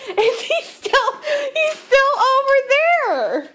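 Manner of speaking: very high squeal, close to crying with laughter; helplessly amused, slow high-pitched wail; hysterical amusement. Real, not acted. Owner seeing her dog still stuck, hysterical amusement. Cracking up at dog not moving.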